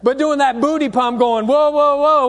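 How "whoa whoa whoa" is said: higher, louder hyped-up whoa; mock hype. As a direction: ham up a party crowd cheering. Mocking twerk video exclamations.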